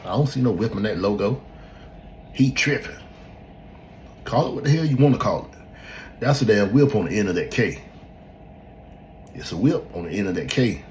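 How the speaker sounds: worked-up mimicry of naysayers; imitating indignant skeptics. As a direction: argue it like a stubborn doubter. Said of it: "look at it and be like".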